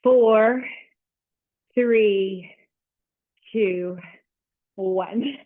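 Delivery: loud coach countdown. Real, not acted.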